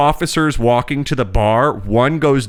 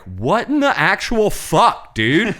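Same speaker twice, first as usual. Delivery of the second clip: slow, much higher disbelief; stunned outburst; 7 semitones higher, slower than usual. Retold or imitated. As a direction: drag out the shock, pitch way up. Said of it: "and I'm like".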